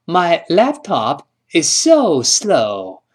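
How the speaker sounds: slow, careful English read; patient teacher demo; slow model sentence. Shown as a demonstration. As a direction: spell the sentence out slowly. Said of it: "我说".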